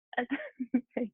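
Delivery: real stifled giggle; short helpless fit of giggles. Real, not acted. Yoga teacher mid-class, helpless giggling. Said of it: "I have the giggles".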